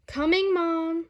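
put-on child calling back. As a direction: shout back to mom from another room. Sally calls back.

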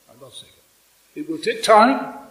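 ordinary talk, nothing marked

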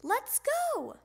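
high, drawn-out summons; father hollering from another room; calling out. A narrator's reading. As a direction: call it out high to hurry him along. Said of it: "called his father".

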